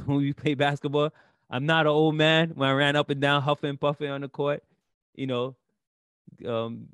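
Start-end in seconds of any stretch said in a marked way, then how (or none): 1.5-2.5 retold yell. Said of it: "why I used to yell".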